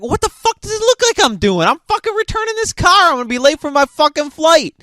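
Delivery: a stressed traveller losing it at the gate attendant; exasperated outburst. Retold or imitated. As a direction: fire it back, fed up and rushing. His exasperated reply to gate lady.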